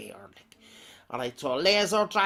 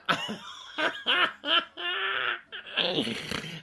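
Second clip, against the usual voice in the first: a long, high burst of laughter; real laughter; 13 semitones higher than usual. Real, not acted. Laughs at a name.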